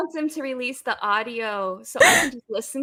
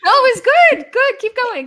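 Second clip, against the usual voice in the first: excited cheer; 12 semitones higher, 11 dB louder than usual. Real, not acted. Cheering on a friend's accent.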